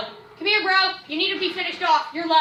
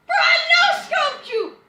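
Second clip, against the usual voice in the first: triumphant shout; 9 semitones higher, slower than usual. Real, not acted. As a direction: crow over the shot.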